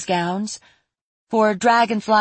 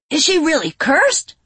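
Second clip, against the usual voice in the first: surprised; 11 semitones higher, 6 dB louder than usual. Acted. Ver asks, surprised.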